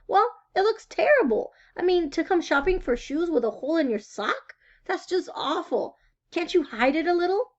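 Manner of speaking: high, fretful mother; a worried mother going on and on; fussing mother. Acted. Mother frets about sock hole.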